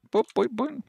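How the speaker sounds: high-pitched little boop noises; light, high "boop" sound effects; playful boops. Pretend. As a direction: make tiny cartoon hop sounds, high. Playful noises while hopping in game.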